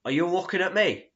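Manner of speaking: imitating a pub hard man shouting; mock shout. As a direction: call it across the pub like a lout. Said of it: "he shouts over".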